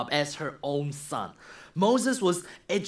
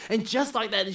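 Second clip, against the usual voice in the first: ordinary delivery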